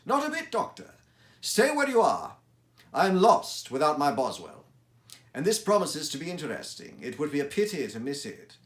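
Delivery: high, loud and insistent; Holmes voice. Acted. Holmes urges Watson to stay, high and insistent. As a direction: keep your friend in his seat, brightly firm.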